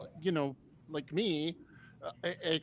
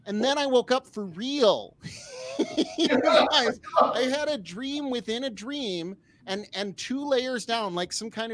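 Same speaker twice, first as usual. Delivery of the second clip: excited; 7 semitones higher, 7 dB louder than usual. Real, not acted. Excited punchline, laughing.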